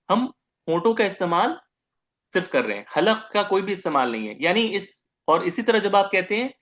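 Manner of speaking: usual speaking voice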